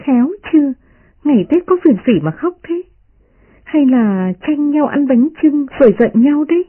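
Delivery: put-on high-pitched playful young lady; voiced storybook girl, poking fun; smiling teasing girl. A narrator's reading. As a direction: tease them in a high, lilting character voice. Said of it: "Đại Ngọc cười nói".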